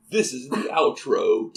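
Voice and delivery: slow, drawn-out announcing; hammed-up outro announcement. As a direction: announce the outro slowly and grandly. Hammed-up outro announcement into silly noises.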